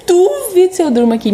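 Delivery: high, loud scoff of disbelief; a new mom scoffing at the idea of sleeping in the ward. Retold or imitated. Reacting in disbelief.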